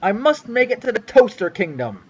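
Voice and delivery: usual speaking voice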